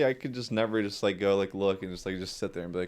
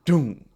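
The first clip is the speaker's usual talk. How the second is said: loud, higher 'doom' crash noise; imitated door bang; 6 semitones higher, 6 dB louder than usual. Driver mimics girl's door hitting the truck, loud bang noise. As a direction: sound out the impact suddenly and loudly.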